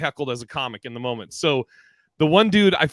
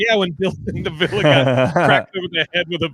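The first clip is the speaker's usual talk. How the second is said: laughing through it; 3 semitones higher, 3 dB louder than usual. Retold or imitated. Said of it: "And Manny's like".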